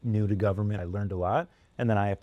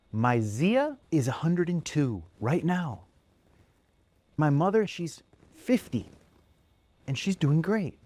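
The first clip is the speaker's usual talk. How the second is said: put-on higher, slow child voice; sweet little boy; 7 semitones higher, slower than usual. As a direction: list it innocently like a small boy. Little boy character speaking.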